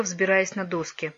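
ordinary delivery, nothing marked

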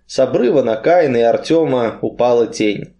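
ordinary delivery, nothing marked

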